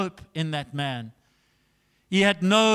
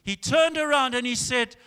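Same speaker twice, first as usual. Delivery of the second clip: ordinary talk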